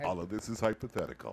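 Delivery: low, booming public-service announcer; big low announcer voice; grandote voice. Pretend. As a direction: say it big and deep, like an official disclaimer. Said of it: "get in your grandote voice and say".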